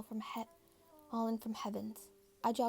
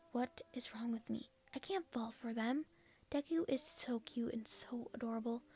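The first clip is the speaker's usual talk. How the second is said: usual speaking voice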